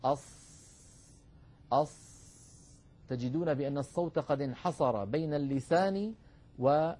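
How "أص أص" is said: أص held tight, the sound trapped between tongue and palate; sad with sealed tongue. Shown as a demonstration. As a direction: demonstrate the closed أص. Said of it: "جربوا هكذا". (أص بإطباق)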